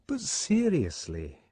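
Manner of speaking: Fanny going slow and serious; serious turn. Acted. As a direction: drop the gossip, get earnest. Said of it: "with a sudden change of tone".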